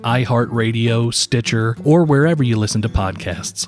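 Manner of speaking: brisk, upbeat announcer sign-off; host signing off the episode to listeners, announcer style; podcast outro read. Acted. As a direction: sell the show to listeners like an ad read.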